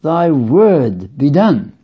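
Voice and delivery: "thy word be done" said slowly, leaning on "done"; weighty slow stress with the peak on "done"; slow emphasis. Shown as a demonstration. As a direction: land hard and slow on "done". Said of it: "I am saying this morning as an emphasis".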